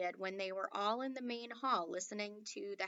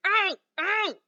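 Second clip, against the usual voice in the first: high, loud yelps; shouting out; 10 semitones higher, 13 dB louder than usual. A narrator's reading. Omri yelping at every step, high and loud. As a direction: hop and yell with each jab. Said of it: "Omri dancing and shouting".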